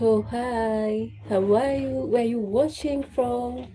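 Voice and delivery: normal talking voice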